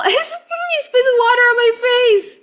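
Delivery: real delighted shriek of laughter; laughing shriek. Real, not acted. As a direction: shriek it while laughing helplessly. Seal spits water, she shrieks.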